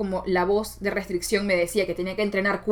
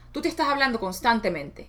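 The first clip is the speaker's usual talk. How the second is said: ordinary talk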